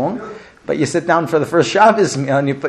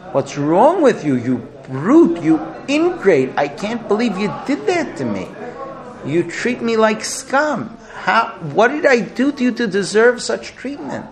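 mock shriek of an outraged young bride; shrieking wife; 7 semitones higher than usual. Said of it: "shrieking at the top of her lungs".

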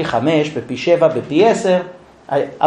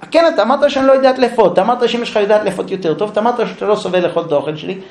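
hurt wife accusing; 4 semitones higher, faster than usual. Acted. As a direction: rattle off her complaints, hurt and fast. Wife accuses husband about kugel.

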